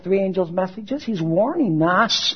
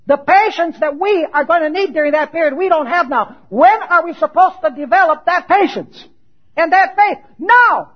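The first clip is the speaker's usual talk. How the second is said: loud, raised voice building to a punch; forceful preaching; 8 semitones higher, 6 dB louder than usual. Acted. Preacher urging people to develop patience now, forceful. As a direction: press the challenge on the congregation.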